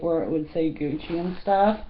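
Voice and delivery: ordinary talk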